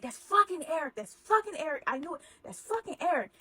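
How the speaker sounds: imitating an enraged boss; angry recognition. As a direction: spit out the name like he knew it, mock fury. Boss recognizes wife's lover's truck.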